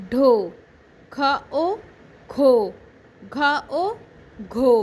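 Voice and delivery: put-on teacher sounding out syllables for kids to repeat; syllable drill. Shown as a demonstration. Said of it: "میرے ساتھ رہیے اور پڑھیے،". (بچوں کو آوازیں جوڑ کر سکھاتی استانی)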